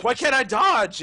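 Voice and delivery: high, loud whiny complaint; exasperated. Retold or imitated. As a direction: echo the whine in disbelief. Echoing the dodge complaint.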